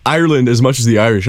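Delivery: ordinary delivery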